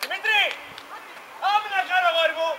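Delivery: loud, high pitch-side shout; shouting to players. Real, not acted. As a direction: yell it across the pitch. Coach calling out to players.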